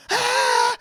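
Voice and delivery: high, loud gasping "ah"; horrified gasp, hammed up; mock scream. Child seeing mum's perm, loud mock scream. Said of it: "like, went".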